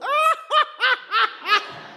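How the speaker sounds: very high, squealing laugh. Real, not acted. Picturing the friend hitting the floor, a high squealing laugh.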